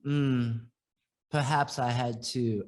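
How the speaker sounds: put-on friend's British accent, low and shy; imitating British accent. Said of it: "he has a British accent".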